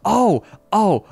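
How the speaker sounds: quick high yelps of remembering; excited realization. Real, not acted. Streamer remembers something to show viewers, excited. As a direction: burst in with repeated high-pitched ohs.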